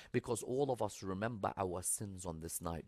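ordinary delivery

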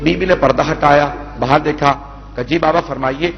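measured, mournful majlis recitation; masaib recitation. Acted. Reciter telling the Kufa story to mourners, mournful. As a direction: chant the tragedy to the mourners.

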